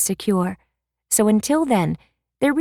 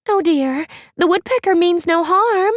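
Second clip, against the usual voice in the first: much higher, gentle fretting voice; a sweet girl fretting kindly; Ashlyn; 11 semitones higher, 4 dB louder than usual. Acted. Ashlyn reassures.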